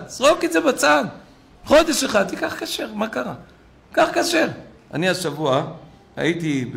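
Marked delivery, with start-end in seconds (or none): 0.1-4.5 high-pitched, insistent urging; exhorting. Real, not acted. Rabbi urges listeners to give up the iPhone, exhorting. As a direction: plead in a raised pitch, as if it were simple.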